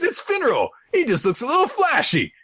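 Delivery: high-pitched earnest defence; put-on squeaky insistence; insistent boy. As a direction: vouch for your friend in a high voice. Acting Asta defending friend.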